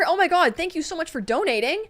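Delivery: high, loud burst of gratitude; excited thanks. Real, not acted. Streamer thanking a donor, excited and loud. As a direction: gush over the donation, pitch jumping up.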